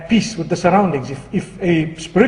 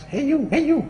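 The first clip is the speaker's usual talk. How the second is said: high, softer parrot call; 7 semitones higher, 5 dB quieter than usual. Acted. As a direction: pipe up like a talking bird. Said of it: "same thing happens".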